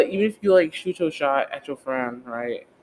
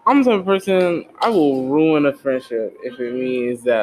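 ordinary talk

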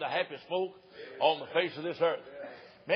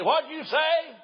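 put-on high, loud squawk of a deaf old woman; shrill old wife; 10 semitones higher, 6 dB louder, much slower than usual. Deaf wife asks husband.

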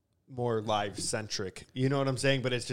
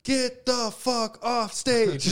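high, drawn-out heckle; stretched-out mock jeer, pitched way up; imitated crowd yell; 11 semitones higher, 4 dB louder, much slower than usual. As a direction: mimic a whole crowd yelling in slow unison. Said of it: "the entire crowd would go".

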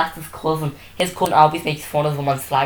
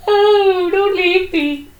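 mock pleading; 4 dB louder, much slower than usual. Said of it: "I was like".

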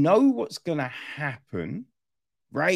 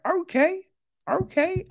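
much higher, impressed okay okay. Retold or imitated. Viewer reacting to the action, higher impressed okay. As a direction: rise high, pleasantly surprised. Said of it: "you're like".

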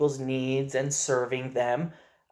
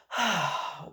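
contented sigh; 6 semitones higher than usual. Retold or imitated. Dad imitating his son's sigh to himself outside, a contented sigh. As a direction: breathe out happily like a content child. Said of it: "he just kind of sighs to himself and goes".

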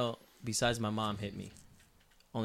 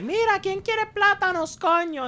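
high, loud calling Puerto Rican mom; 7 dB louder, slower than usual. Retold or imitated. Mom at the stove calling the kids, in a high, loud shout. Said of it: "your mom's in the stove and she's".

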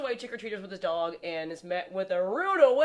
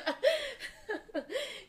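high laugh; 5 dB quieter than usual. Real, not acted. Laughs at joke.

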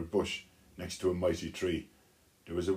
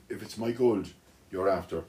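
ordinary delivery, nothing marked

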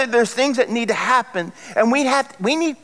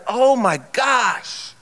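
higher, drawn-out exclamation of dismay; mortified shock; 4 semitones higher, much slower than usual. Retold or imitated. Preacher retelling his mistake with the wrong lady, mortified. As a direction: stretch it out in retold embarrassed shock.

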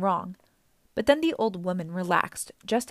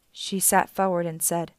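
ordinary delivery

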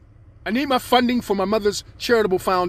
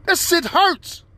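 normal talking voice

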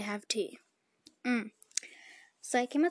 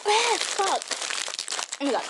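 sharp high pained yelp at the burn; a real cry, mouth on fire; reacting to spice; 8 semitones higher than usual. Real, not acted. Mouth burning from spicy Takis.

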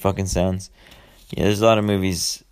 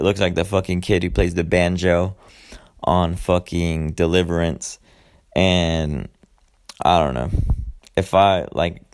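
normal talking voice

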